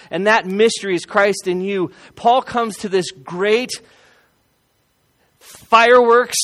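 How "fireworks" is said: loud emphatic word. Acted. Preacher builds to his climax.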